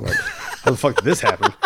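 real laughter breaking into the words; laughing. Real, not acted. Recalling driving drunk away from jail, laughing. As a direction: laugh through it in disbelief at yourself.

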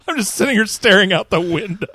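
giggling through the words; laughing. Real, not acted. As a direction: laugh while you say it. Laughing at own slip.

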